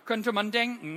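usual speaking voice

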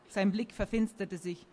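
ordinary delivery, nothing marked

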